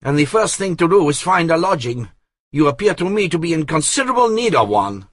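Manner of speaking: high, brisk and commanding; fast, brisk orders to his chief; confident young Italian. A narrator's reading. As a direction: Guccio briskly arranging lodgings. Guccio takes charge.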